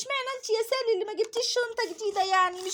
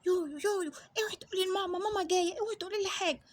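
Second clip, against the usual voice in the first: put-on much softer, anxious little girl begging; scared pleading girl; 4 semitones lower, 6 dB quieter than usual. Bully girl begging friends not to tell mum, hushed.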